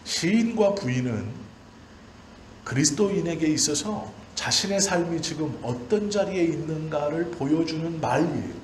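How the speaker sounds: high, loud, impassioned pulpit cry, pressing each clause; preaching at full force. Acted. Pastor to his congregation on shame and glory, fervent full-force preaching. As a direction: preach it out to the back row.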